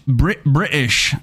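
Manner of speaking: slow, drawn-out British accent. Shown as a demonstration. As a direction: try out British, slowly. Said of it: "is the British evil voice?".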